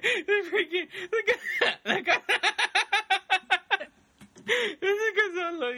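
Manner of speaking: high, squeaky words through real laughter; helpless laughter. Real, not acted. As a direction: try to talk while cracking up. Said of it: "Just laugh".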